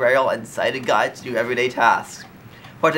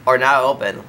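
ordinary talk

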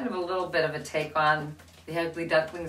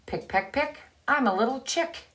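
little chick voice; 7 semitones higher than usual. Acted. Brown chick's refrain again.

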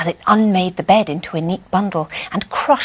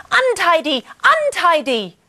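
higher chanted shout; 10 semitones higher than usual. A narrator's reading. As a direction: taunt the robot. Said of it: "shouted as they ran".

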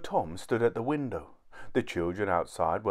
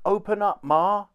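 high, loud plea at the door; urgent shout; 10 semitones higher, 5 dB louder than usual. A narrator's reading. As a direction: shout to be let in. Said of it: "he shouted".

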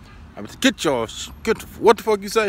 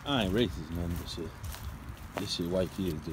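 ordinary talk, nothing marked

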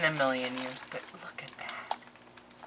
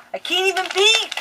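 much higher, much louder cry; excited squeal; 14 semitones higher, 11 dB louder than usual. Real, not acted. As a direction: squeal in frustrated delight. Frustrated at taped box.

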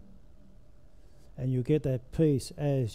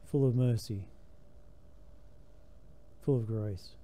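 prayer; 6 dB quieter than usual. Acted. Opens with a sung-style prayer.

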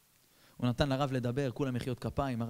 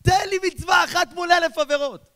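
much higher, louder booming shout; God's voice; 12 dB louder than usual. Retold or imitated. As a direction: call out as God demanding one mitzvah. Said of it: "אומר בורא עולם". (קול בורא עולם, צעקה גבוהה ורמה)

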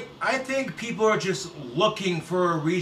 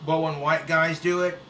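ordinary delivery

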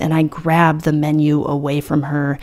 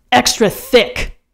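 insistent; 7 semitones higher, slower than usual. A narrator's reading. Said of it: "I add".

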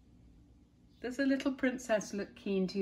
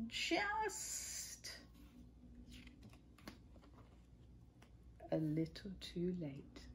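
whisper; 15 dB quieter, much slower than usual. A narrator's reading. Punchline of the potty dash.

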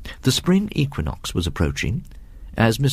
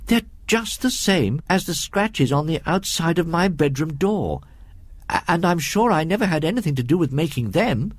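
put-on higher, earnest child voice; protesting boy; 7 semitones higher than usual. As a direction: plead innocence like a worried schoolboy.

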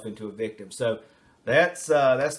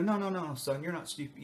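soft, consoling mock parent; reassuring parent; 4 semitones higher, 6 dB quieter than usual. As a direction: comfort the sad child. Parent comforting child.

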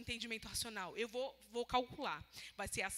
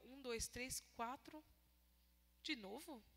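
quiet puzzled counting; 9 dB quieter, slower than usual. Retold or imitated. Counting food again, puzzled.

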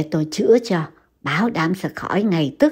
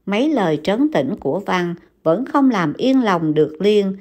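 plain reading voice

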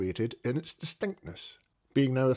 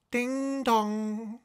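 high ringing ding dong like a clock bell; bell chime; 12 semitones higher than usual. A narrator's reading. Narrator imitating the clock bell, high ringing chime.

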